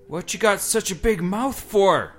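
put-on high, slow boy's voice; frightened boy. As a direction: ask it nervously like a child. Boy questions the creature.

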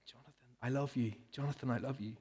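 softly voiced mother's loving words; tender mother. Mother speaks to her baby hearing for the first time, soft and tender. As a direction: murmur it like a mum to her baby.

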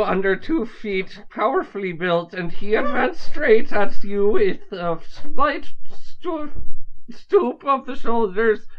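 attempted Irish accent on one breath. Shown as a demonstration. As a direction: read in an Irish lilt until the air is gone. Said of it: "read the opening paragraph in an Irish accent".

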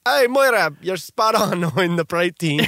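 put-on failed Scottish accent, breaking into a laugh; attempted Scottish accent. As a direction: call it out in a bad Scottish accent. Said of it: "That wasn't even a Scottish accent".

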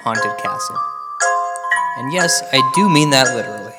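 late night radio show intro; spooky radio host welcome; ominous late night radio. Acted. Show intro over music.

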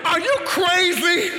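fake-yell at a kid who should know better; exasperated parent. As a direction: parent scolding a child, mock exasperated. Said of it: "We say things like".

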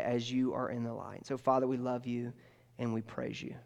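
low, hushed prayer voice; closing prayer. Real, not acted. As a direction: pray softly and evenly, settling to a quiet amen.

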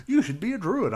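high-pitched quiz question; mock quiz voice. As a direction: read it like a silly quiz, high up. Voicing the quiz result.

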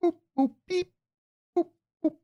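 high beeping robot noises; toy robot voice. Pretend. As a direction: beep and boop like a toy robot. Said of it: "give me your pitch for your show".

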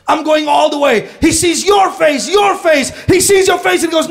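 loud, high, driving sermon delivery; preaching at full force. Acted. Pastor rousing the church, loud full-force preaching. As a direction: pound the message home to the congregation.

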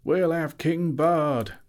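high, loud, slow crowd shout; shouted reply. A narrator's reading. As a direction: shout back defiantly at the master. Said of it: "the people near at hand shouted in reply".

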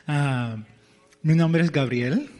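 usual speaking voice

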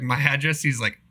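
ordinary talk, nothing marked

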